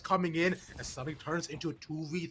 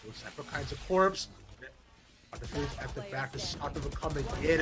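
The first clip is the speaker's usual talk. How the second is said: normal talking voice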